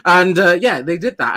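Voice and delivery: ordinary talk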